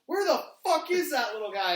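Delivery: high, very loud wrestler's holler; imitated yelling. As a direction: holler it across an empty arena. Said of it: "you can hear".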